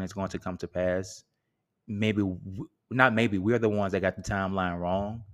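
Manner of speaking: ordinary delivery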